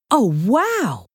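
impressed and astonished; amazed. Acted. Assistant is shown the little statue, amazed.

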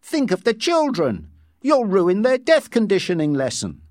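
high-pitched stern scolding; severe. A narrator's reading. Head nurse guarding the children's lesson, severe. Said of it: "she said severely".

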